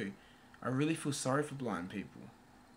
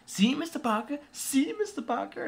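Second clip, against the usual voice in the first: put-on teasing kid; 13 semitones higher than usual. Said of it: "having a muck around saying".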